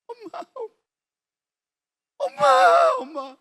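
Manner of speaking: high, rising wail for mother; frightened boy calling out to his mother; terrified child's cry. Retold or imitated. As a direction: scream for her in terror.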